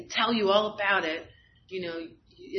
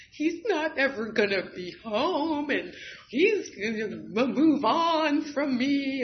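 upset inner thought; 6 semitones higher, 5 dB louder, slower than usual. Retold or imitated. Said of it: "'cause I'm getting upset".

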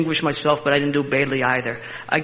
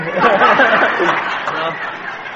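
hearty laugh; 3 semitones higher, 4 dB louder than usual. Real, not acted. Continued laughter.

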